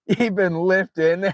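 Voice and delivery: high, giggling through the words; can barely get it out for laughing. Real, not acted. Streamer cracks up at a photo, laughing.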